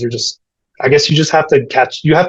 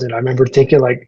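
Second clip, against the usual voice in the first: ordinary talk, nothing marked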